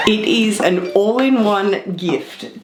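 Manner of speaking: ordinary talk, nothing marked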